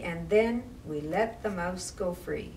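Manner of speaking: ordinary delivery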